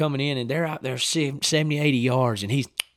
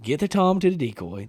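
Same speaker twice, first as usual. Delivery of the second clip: usual speaking voice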